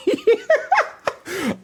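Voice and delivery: sudden high-pitched laugh. Real, not acted. Laughing at himself over his cookie craving, high-pitched laugh.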